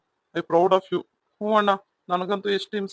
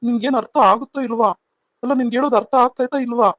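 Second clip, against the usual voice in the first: angry shouting; 3 semitones higher, 5 dB louder than usual. Acted. Husband shouting at wife.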